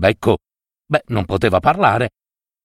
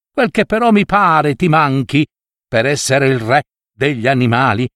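higher, teasing fox voice, slowed on the jab; mocking fox; 6 semitones higher than usual. Fox trotting off with the meat, mocking the crow. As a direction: gloat and mock the crow as you leave. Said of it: "deridendo il corvo".

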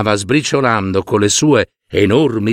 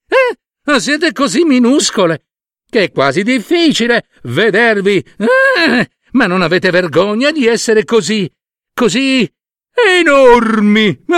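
higher, sneering braggart voice; mocking elephant; 8 semitones higher, 3 dB louder than usual. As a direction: tease the tiny ants with mock awe. Elephant mocks the ants. (elefante sbruffone che deride le formiche)

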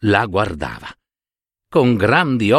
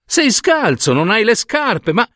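astonished cry; 13 semitones higher than usual. A narrator's reading. Shoemaker discovers the child is barefoot, astonished high cry. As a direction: cry out in disbelief, pitch leaping up. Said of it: "gridò".

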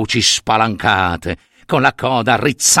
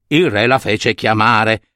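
ordinary delivery

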